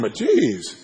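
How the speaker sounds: ordinary delivery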